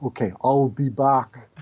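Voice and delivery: put-on slow, drawn-out Austrian accent; slow Austrian "I'll be back"; Schwarzenegger impression. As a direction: deliver the catchphrase slowly, like Arnold. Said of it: "huh, Arnold?".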